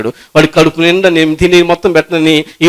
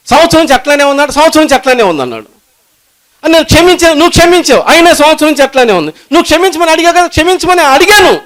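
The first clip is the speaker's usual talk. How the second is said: much higher, louder, fervent preaching; climactic preaching; 13 semitones higher, 5 dB louder than usual. Acted. Preacher voicing the exasperated king at full force. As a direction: drive it home at full force.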